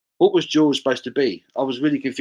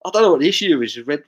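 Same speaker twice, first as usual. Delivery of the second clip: usual speaking voice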